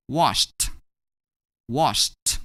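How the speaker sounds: "washed" in one syllable ending "sht". Shown as a demonstration. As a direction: finish "washed" on a separately released t. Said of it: "we're gonna pronounce it as".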